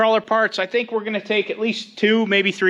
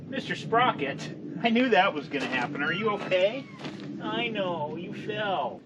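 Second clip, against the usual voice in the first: quiet; 10 dB quieter than usual. Acted. Comforting a fallen toy.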